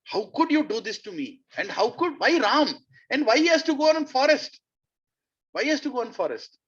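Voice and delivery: anguished pleading, pitched high; a father's heartbroken protest; devastated king. Retold or imitated. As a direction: cry out in disbelief, voice climbing. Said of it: "completely devastated".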